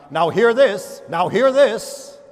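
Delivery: loud, high ship's loudspeaker call; mock PA call in the mind; loudspeaker announcement. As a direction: blare it like a PA announcement. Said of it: "out of a loudspeaker of my mind".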